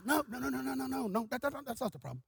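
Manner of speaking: quick soft string of repeated denials; Brother Buddy's stutter. Retold or imitated. Stuttering evangelist waves off the wrong guess, soft stammer. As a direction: stammer it out quietly. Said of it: "He just stuttered".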